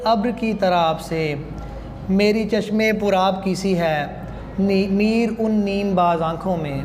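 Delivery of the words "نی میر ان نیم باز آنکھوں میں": normal talking voice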